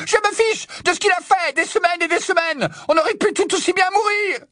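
very high, screamed tirade; hurlement furieux. Acted. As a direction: keep screaming in outrage. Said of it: "hurla-t-elle". (tirade hurlée, suraiguë)

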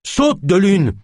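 high-pitched shouted plea; urgent call. Acted. Naya reaches out to De Lune, calling urgently. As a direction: call out to make him jump.

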